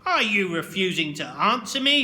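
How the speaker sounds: loud angry demand; teacher shouting at silent pupil; shouted. A narrator's reading. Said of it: "he shouted".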